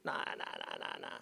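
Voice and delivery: comic low-voiced no, fading out; deep comic no. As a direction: drop into a deep growl for the noes.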